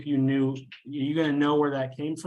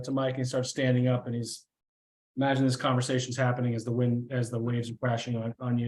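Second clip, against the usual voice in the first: ordinary delivery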